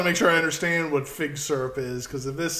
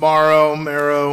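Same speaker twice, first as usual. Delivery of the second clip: loud marrow; 11 dB louder, slower than usual. Pretend. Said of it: "There is no bone marrow in this".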